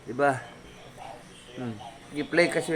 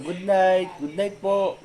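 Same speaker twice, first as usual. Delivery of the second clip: higher, sweet put-on pet voice; pet's voice; 4 semitones higher, slower than usual. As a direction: goodnight on behalf of the pet. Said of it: "Sweetie, sabi mo".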